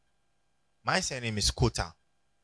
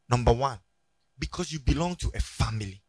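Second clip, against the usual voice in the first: usual speaking voice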